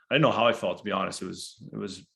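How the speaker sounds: normal talking voice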